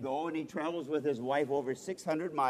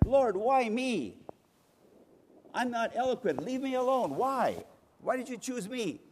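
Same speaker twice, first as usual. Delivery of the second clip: higher, pleading protest; Jeremiah protesting; 8 semitones higher than usual. Retold or imitated. Jeremiah tells the Lord to leave him alone, pleading higher. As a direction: beg off the call, voice raised high.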